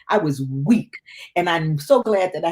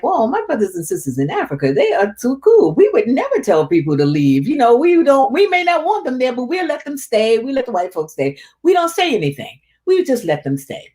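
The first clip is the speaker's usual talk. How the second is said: high, bright-eyed retold excitement, pitch jumping up; recalling her eager, trusting thoughts with lifted pitch; excited; 8 semitones higher than usual. Remembering her first day in Africa, excitedly high and eager. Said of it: "And I'm, like, excited".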